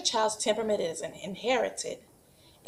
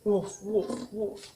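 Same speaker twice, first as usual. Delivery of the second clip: 1 mock dog barking; much slower than usual. Shown as a demonstration. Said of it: "What sound does a dog make?".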